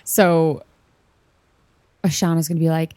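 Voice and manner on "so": usual speaking voice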